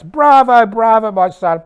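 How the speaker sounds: high, loud cheer; shouting. Acted. Mother cheering her dead son, high shouting. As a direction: cry out at full voice, easing slightly on the last words. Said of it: "Mother shouts".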